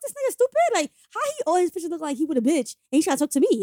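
can't believe how dumb he is; high pitched outraged disbelief; incredulous. Retold or imitated. Her outraged inner reaction.

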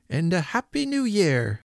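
hearty reply pitched well up; Father Christmas. A narrator's reading. Deaf old man answering beside the point, high and hearty. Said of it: "said he in a high-pitched voice".

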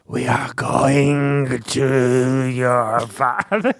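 low, very slow, slurred sage voice; drunk Yoda. Acted. Reading Mrs Which, mocked as a drunk Yoda. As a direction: drawl it like a tipsy old Yoda. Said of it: "like a drunk Yoda".

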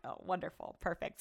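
deadpan wonderful, perfect; sarcastic. Retold or imitated. Realizing the manager knows her name, sarcastic and soft. Sarcasm about being recognized.

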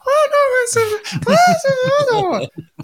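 very high, very slow squeaky alien; tiny alien voice. Acted. Dad reading an alien's line to his son, very high and slow. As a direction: drag it out in a squeaky alien whine. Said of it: "all their voices are very".

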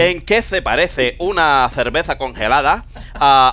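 Castilian accent with lisped c and z; acento español. Shown as a demonstration. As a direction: tell the riddle like a Spaniard. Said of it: "con acento español". (adivinanza con acento de España)

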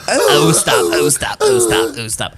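mock moans, pitch jumping about; imitated pained moaning. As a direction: mimic a winded victim groaning in pain. Imitating fallen grape lady's moaning.

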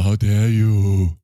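slow, dragged mock outrage; a hint of a scolding activist; Greta Thunberg voice. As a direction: drag the words out in parody. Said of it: "now you sound like Greta Thunberg".

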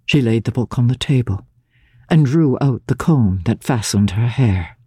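usual speaking voice

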